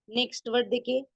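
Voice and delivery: normal talking voice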